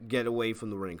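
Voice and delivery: ordinary delivery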